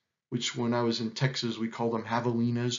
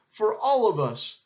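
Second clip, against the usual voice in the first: high, happy toast; cried cheer; 7 semitones higher, 3 dB louder than usual. A narrator's reading. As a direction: finish the cheer joyfully. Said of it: "they cried".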